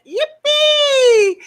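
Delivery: high, loud yippee shout; cheer. Acted. Streamer cheering a friend's surprise visit, high loud shout. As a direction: cheer it out, thrilled.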